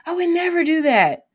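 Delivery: high, firm refusal in a voiced client; hoped-for reply. Counselor voicing a client's firm refusal, high and sure. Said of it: "it'll be great if the person says".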